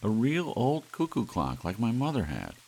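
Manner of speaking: high, loud, thrilled; overjoyed. Acted. Doris to Larry, thrilled by the clock. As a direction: gush over it with joy.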